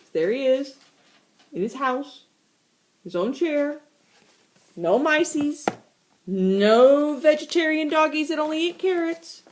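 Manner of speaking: ordinary delivery, nothing marked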